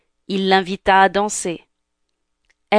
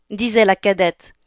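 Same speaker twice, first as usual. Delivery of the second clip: plain reading voice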